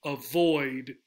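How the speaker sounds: avoid with VOID long and stressed; avoid, light first syllable, drawn-out falling VOID; stress on second syllable. Shown as a demonstration. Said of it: "It's in the second syllable".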